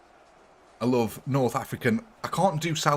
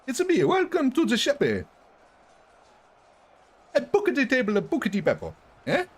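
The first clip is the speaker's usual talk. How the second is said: high cartoon voice; 13 semitones higher than usual. High Italian-style voice bit.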